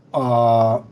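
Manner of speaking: normal talking voice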